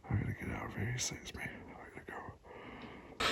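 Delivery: urgent scared whisper; frightened whisper. Real, not acted. To viewers while the moose watches him, a frightened whisper. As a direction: breathe it out so the moose won't hear.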